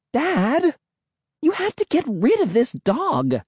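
very high, shrill demand. A narrator's reading. Emily demanding Dad get rid of dog, shrill. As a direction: plead shrilly in a high put-on girl's voice. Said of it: "Emily said shrilly".

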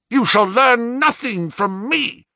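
high, loud, slow refusal; passionate defiance. A narrator's reading. Son refuses his father any answers, passionately defiant. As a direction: fling the refusal back with passion. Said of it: "with a passion such as".

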